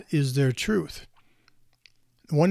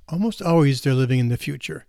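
ordinary talk, nothing marked

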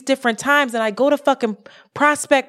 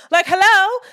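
exasperated hello; 10 semitones higher, 5 dB louder than usual. Real, not acted. Exasperated point to the obvious.

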